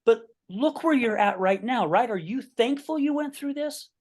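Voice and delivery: pushing the question with rising urgency; excited. Real, not acted. Host presses the guest on whether he is thankful, pitch soaring with excitement.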